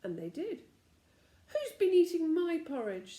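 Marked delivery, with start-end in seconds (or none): 1.5-3.2 loud angry demand; angry father bear. A narrator's reading. Father bear finds his porridge eaten, loud and angry. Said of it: "said the father bear angrily".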